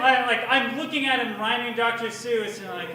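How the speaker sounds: normal talking voice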